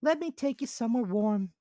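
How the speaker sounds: high squeaky ant character. Acted. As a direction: voice the ant as small and sensible.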